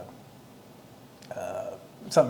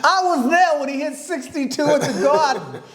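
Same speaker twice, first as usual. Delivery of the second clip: put-on old fan; 10 semitones higher, 3 dB louder than usual. Mocking old fans at races.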